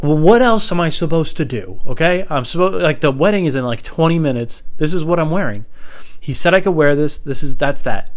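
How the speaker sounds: worked-up indignant protest; so mad. Acted. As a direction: argue back hotly, refusing to budge. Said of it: "I'm so mad right now".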